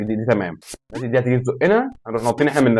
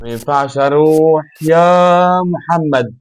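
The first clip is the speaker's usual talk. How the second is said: slow, high, louder drawn-out plea; whining call; 6 semitones higher, 6 dB louder, much slower than usual. Real, not acted. Gamer whining to Mohamed he can't move, stretched and loud. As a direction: drag the words out begging your teammate. Calling teammate Mohamed while stuck.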